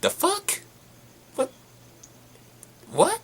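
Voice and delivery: put-on baffled double take at the accent change; stunned disbelief. Recalling his reaction to the twin's new accent, stunned disbelief. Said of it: "And I'm like".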